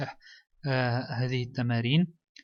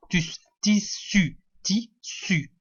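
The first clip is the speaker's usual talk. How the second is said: slow, split syllables; 3 semitones higher, much slower than usual. Shown as a demonstration. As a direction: sound out the two syllables separately. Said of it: "écris les mots sous les images". (مقطعًا مقطعًا ببطء)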